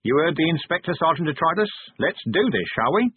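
high-pitched, brisk command to a sergeant; spirited call to action; rallying order. Acted. Vimes passes on rallying order to troll.

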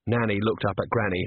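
ordinary delivery, nothing marked

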